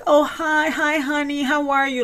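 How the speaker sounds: high, sugary phone greeting; Teresa's sweet greeting. Retold or imitated. Teresa greeting her brother on speakerphone, high and sweet. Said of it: "And then Teresa's like".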